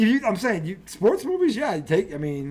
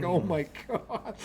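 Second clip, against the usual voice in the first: awed whisper of a smile; 8 dB quieter, much slower than usual. Retold or imitated. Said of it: "his son just got a smile like".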